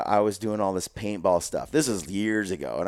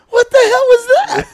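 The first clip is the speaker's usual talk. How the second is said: much higher, very loud incredulous shriek; stunned; 13 dB louder than usual. Retold or imitated. Teller reacting to his brother-in-law's charge, shrieked disbelief. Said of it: "I'm like".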